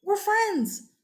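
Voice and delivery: high, whiny protest; the Matamba whining to Portugal, high and hurt; complaining to an ally. Retold or imitated. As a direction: plead with a friend who let you down. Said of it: "The Matamba go to Portugal and say".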